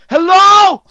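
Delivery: shrieked sky-high greeting; loud high hello. Retold or imitated. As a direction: belt out a squeaky hello.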